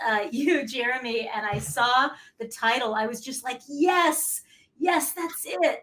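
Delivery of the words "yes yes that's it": high, drawn-out retold excitement; delighted recognition. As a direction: relive an emphatic "that's it!" moment. Acts out delighted first reaction to title.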